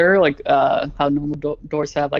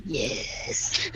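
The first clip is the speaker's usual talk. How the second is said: growling voice; 10 dB quieter than usual. Acted. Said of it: "A deep growl, growling voice".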